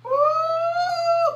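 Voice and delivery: ooh flung up high, then Jesus; a spirit-filled cry soaring up; high ecstatic ooh. Real, not acted. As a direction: falsetto praise cry. Preacher overcome mid-sermon.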